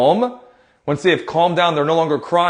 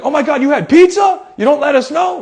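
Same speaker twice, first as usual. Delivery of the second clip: high, loud, hammed-up shock; playful shouted disbelief; mock group amazement; 11 semitones higher, 4 dB louder than usual. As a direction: exclaim in pretend outrage, high and loud. Team joins in the joking amazement.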